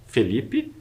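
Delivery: Felipe with a closed E, like "fay-LEE-pee". Shown as a demonstration. Teacher modelling the closed vowel, Felipe with a closed E. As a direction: tighten the first vowel of Felipe. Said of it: "é um som com uma vogal mais fechada".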